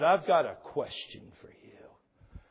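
slow eerie whisper. Retold or imitated. Pastor imitating a stranger's creepy whispered question. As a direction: breathe it slowly like a spooky stranger.

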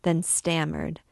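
ordinary delivery

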